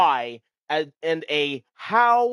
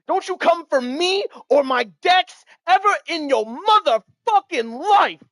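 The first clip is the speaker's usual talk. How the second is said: high, loud hammed-up warning to his playgroup; mock threat; 11 semitones higher, 4 dB louder than usual. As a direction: play it as an over-the-top threat. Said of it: "is what I wanna say to my pod".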